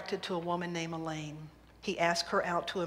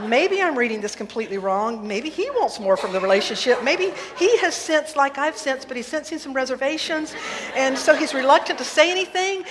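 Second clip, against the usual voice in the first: anxious fretting; 4 semitones higher, 7 dB louder than usual. Acted.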